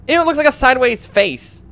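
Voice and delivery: high, loud grossed-out exclamation; disgusted. Real, not acted. Player seeing the creature's sideways face, disgusted. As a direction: squeal it in disgust.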